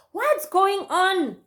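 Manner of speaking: high, slow, drawn-out question; stretched-out retold demand; acted exasperated demand. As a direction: imitate a friend insisting on an answer. Friend demands to know what happened.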